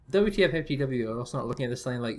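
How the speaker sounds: ordinary delivery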